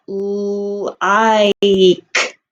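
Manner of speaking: "Like" drawn out slowly, each sound held; stretched out. Shown as a demonstration. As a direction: stretch "Like" long and slow for the kids. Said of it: "Let's try to stretch that out".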